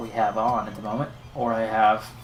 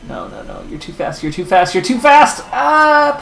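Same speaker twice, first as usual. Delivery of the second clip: panicked build-up; 12 semitones higher, 8 dB louder than usual. Real, not acted. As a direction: escalate in real dismay to a loud, high wail. Rival's lap beats his, panicking.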